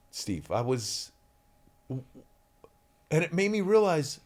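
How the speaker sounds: normal talking voice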